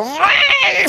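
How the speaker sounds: high slow screeching brake noise; drawn-out brake squeal. Retold or imitated. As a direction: stretch out a tyre screech. Car brakes a third time.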